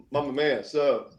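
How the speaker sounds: ordinary delivery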